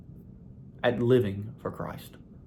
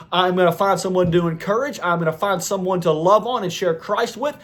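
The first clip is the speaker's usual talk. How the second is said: raised, insistent declarations building one on another; fervent sermon delivery, higher pitched; rousing preaching; 5 semitones higher than usual. Real, not acted. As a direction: declare each resolve like a vow from the pulpit.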